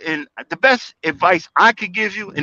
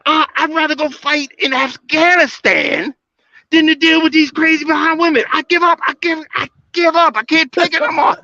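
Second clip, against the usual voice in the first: exasperated cry to the Lord; 9 semitones higher than usual. Retold or imitated. Praying to the Lord after a dating fight, exasperated high cry. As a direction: throw up your hands and cry out to the Lord, fed up. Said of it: "I gave up. I said".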